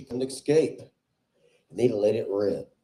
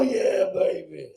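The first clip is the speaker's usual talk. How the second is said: put-on slow, stretched yeah baby; drawn-out catchphrase; 7 semitones higher, slower than usual. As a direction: savour each word like a cheesy lover. Catchphrase after shout.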